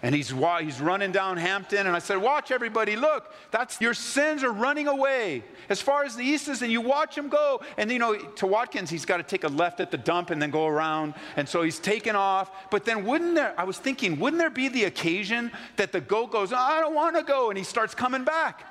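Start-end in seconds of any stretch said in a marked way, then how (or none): 2.2-7.6 rally everyone to watch; excited announcing. Pretend. Pointing congregation at fleeing goat. 16.5-17.5 high, whiny goat voice; the goat refusing to leave, whining. Pretend. Voicing the reluctant goat.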